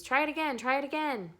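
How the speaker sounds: high, loud goose call; goose urging. A narrator's reading. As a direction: egg him on like a honking goose.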